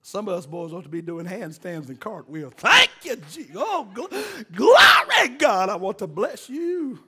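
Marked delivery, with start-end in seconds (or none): 2.6-7.0 high, loud shouts of glory; jubilant praise shout. Acted. Preacher showing the men how to praise God, jubilant shout. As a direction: burst into shouted praise, then ease off.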